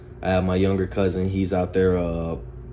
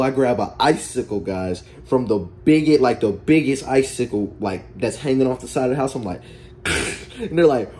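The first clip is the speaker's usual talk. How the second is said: ordinary talk, nothing marked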